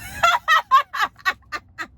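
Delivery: a real, high-pitched laugh bursting out; a long, high laugh that falls away; high burst of laughter. Real, not acted. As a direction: crack up at the memory. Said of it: "I'm laughing because".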